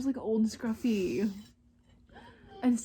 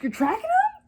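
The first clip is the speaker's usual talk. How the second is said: cowboy surprised; 6 dB louder than usual. Retold or imitated. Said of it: "the cowboy goes".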